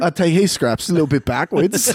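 put-on British; British accent. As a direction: pitch up into a fake British accent. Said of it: "I'll be a British guy".

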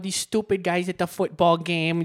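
put-on mom's Minnesota accent. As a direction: do a chatty mother in broad Minnesotan. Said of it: "I think my mom, she's like".

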